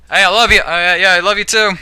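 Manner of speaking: quick casual I love you too; offhand to parents. Retold or imitated. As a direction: toss it off while busy. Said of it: "how you'd say it to your parents".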